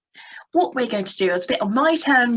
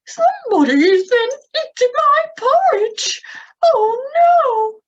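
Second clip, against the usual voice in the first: much higher, louder squeaky mama bear; high Mummy Bear voice; 14 semitones higher, 7 dB louder, slower than usual. Shown as a demonstration. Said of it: "we'll use a quite high voice".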